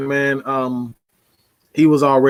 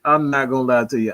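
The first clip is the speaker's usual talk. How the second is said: normal talking voice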